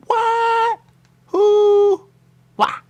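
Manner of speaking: very high, loud squawking; mock shrill squawk. Quoting her startled, very high and loud. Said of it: "And she said".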